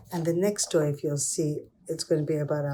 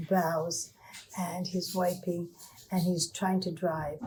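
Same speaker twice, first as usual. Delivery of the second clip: plain reading voice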